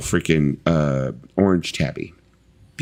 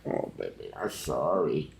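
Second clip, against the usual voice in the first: soft apology to cat; 3 semitones lower, 12 dB quieter, slower than usual. Real, not acted. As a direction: hush it like soothing a pet. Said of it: "He's, like, so mad".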